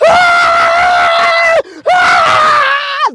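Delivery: high, loud wordless scream; raw scream. Shown as a demonstration. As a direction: let out a long primal scream. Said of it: "you may be fucking screaming".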